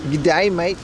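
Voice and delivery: put-on Aussie greeting accent; Australian accent. Streamer teases Australian viewers, imitated Australian accent. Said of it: "Of all the good things you guys have".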